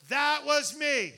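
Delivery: laughing admission of own blame; loud punchline. Real, not acted. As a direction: shout the confession through a laugh. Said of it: "that wasn't the devil".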